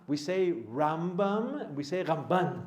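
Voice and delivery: Rambam stressed on the first syllable, Ramban stressed on the last, said slowly; slow and careful, show the stress difference between Rambam and Ramban; yeshiva stress contrast. Shown as a demonstration. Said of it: "The emphasis on the resh or the emphasis on the bet".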